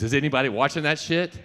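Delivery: loud, high shout of disbelief; excited shout. Real, not acted. A speaker hyping a show to the crowd, shouting. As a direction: blurt it out to the whole room.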